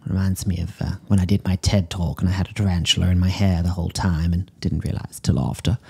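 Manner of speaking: normal talking voice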